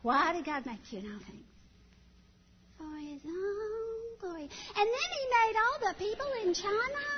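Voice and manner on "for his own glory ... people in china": high eager little voice; child answers catechism then keeps going, high; toddler rambling. Retold or imitated. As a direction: rattle on like a two-year-old. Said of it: "let's try it again".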